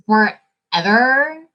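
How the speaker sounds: forever stretched slow with a rising end; slow emphatic forever; drawn-out forever. Real, not acted. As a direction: drag out forever like it's been ages. Repeated for emphasis.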